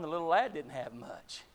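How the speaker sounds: ordinary talk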